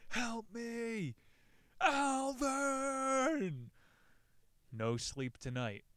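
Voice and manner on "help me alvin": high, loud shouted call for help; desperate shouted call into the dark; mock shout for help. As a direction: call out into the night as if lost. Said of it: "Joe shouts into the night".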